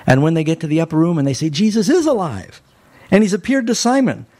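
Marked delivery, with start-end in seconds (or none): 1.5-4.3 high, eager retelling of the news; mild excited announcement. Retold or imitated. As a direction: blurt it out, overjoyed. Disciples bursting with good news.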